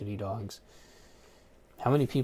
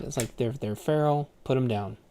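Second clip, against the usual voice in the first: ordinary talk, nothing marked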